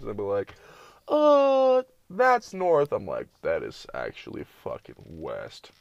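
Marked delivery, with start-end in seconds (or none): 1.1-2.9 high, loud dopey guess; hammed-up clueless imitation, pitched way up; clueless person. Driver asked which way is north, high mock-clueless answer. 3.4-5.7 low, slow, softer put-down; unimpressed correction. Retold or imitated. Correcting someone who pointed the wrong way, low slow disbelief. His deadpan correction in retold exchange.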